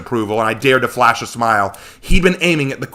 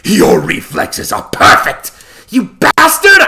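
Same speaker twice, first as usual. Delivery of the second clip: hissed praise snapping into a high shouted curse; pained furious outburst; 11 semitones higher, 7 dB louder, slower than usual. Acted. Wounded heroine cursing Set after her knee is struck, shouting. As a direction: spit it out through the pain, then scream the insult.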